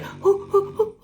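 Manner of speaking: high, hooting mock sobs; imitated crying breaths. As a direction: puff out high, tearful "hoo" breaths in imitation. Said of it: "the tears and then the crying".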